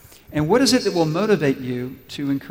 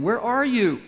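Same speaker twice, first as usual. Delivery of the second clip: mild searching call, raised pitch; 7 semitones higher, 4 dB louder, slower than usual. A narrator's reading. God comes looking for Adam in the garden, gently calling out. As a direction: call out the question, higher, as a reader would. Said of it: "The Lord God called to the man".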